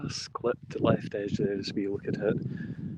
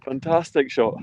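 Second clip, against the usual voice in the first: excited praise; 5 semitones higher, 5 dB louder than usual. Real, not acted. Praise right after the holed putt.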